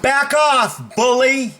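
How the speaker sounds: high, forceful call-out; forceful warning. Shown as a demonstration. Coach demos confronting a bully, high forceful warning.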